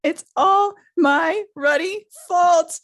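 loud, high, drawn-out mock wail; acted sob, stretched out and high; hammed-up sobbing. A narrator's reading. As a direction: wail it slowly in theatrical self-blame. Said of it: "he sobbed".

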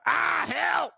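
high shout for help; imitated cry for help. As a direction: call out in mock distress. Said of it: "we heard a".

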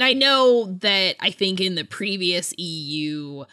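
ordinary talk, nothing marked

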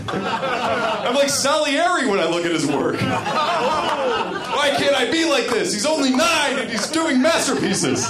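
high, slow, laughing gush of envy; gushing awe. Real, not acted. Gushing in awe to co-host about a genius. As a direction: swoon over his genius, laughing.

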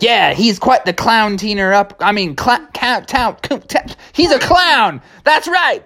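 very high, tongue-tied crook; high outlaw voice, sputtering; Criminal Kevin. Acted. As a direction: stumble over it like a cartoon jailbird. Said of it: "Criminal Kevin".

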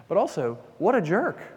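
a sudden high, loud jab of indignation; exasperated jab. Retold or imitated. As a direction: blurt it out like an annoyed disciple. Disciples' exasperation at sleeping Jesus.

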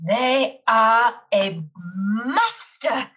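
slow, loud dramatic cry; detective revealing the culprit. Acted. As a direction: proclaim it in grand awe. Dramatic awe at the mystery writer.

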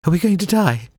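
very high, shrill panicky cry. A narrator's reading. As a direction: squeal it out high and scared. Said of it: "in a shrill voice said".

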